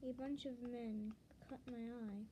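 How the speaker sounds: plain reading voice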